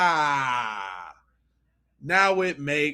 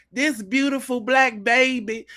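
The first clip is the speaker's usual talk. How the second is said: mocking old Southern mammy voice; 6 semitones higher than usual. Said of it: "trying to talk like an old Southern mammy".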